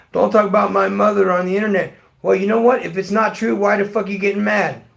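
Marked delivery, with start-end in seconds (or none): 0.1-1.9 loud, higher imitation of an angry son; mock angry son. Father imitating his son's angry protest, loud and higher. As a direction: mimic the son's furious outburst. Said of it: "he got mad and says".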